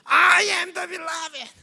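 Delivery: high, loud cry imitating a church member; imitated shout. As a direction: shout it out like an exuberant worshipper. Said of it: "one member shouted".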